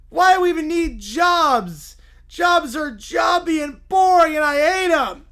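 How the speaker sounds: loud, high, drawn-out wail; whiny Little Bear. Acted. Little bear learning they are unemployed, loud whiny tantrum. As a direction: throw a slow, bawling tantrum at full volume.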